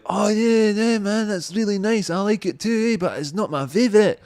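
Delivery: put-on second drunk guy. Mimicking the second drunk replying to his pal. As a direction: play the other jakey, dopey and pleased. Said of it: "the other guy was like".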